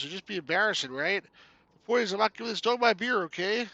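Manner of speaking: lightly voiced folksy Canadian game character; Canuck hero advising. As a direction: give friendly advice in a mild Canuck character voice.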